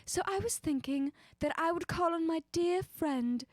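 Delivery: put-on old Hollywood transatlantic accent, pitched high. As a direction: keep up the Audrey Hepburn transatlantic accent. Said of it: "Pluvia suggested a transatlantic accent".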